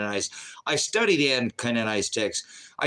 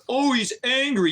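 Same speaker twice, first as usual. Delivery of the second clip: high-pitched mock complaint; mocking a Ken; 11 semitones higher than usual. Host mimicking the critics who call him angry, mocking. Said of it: "Tired of a bunch of Kens".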